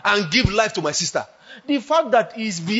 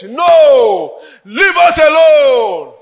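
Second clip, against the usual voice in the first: loud, higher, slow pleading shout; crying shout; 6 semitones higher, 10 dB louder, much slower than usual. Real, not acted. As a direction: cry out to drive Satan off. Rebuking Satan in preaching.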